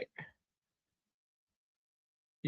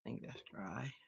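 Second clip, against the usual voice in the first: whisper; 7 semitones lower, 19 dB quieter than usual. Real, not acted. Checking nail polish.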